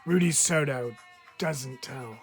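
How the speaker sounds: ordinary delivery, nothing marked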